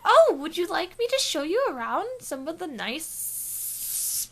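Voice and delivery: high, slow, hesitant offer; eager woman. Acted. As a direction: draw out a sweet invitation.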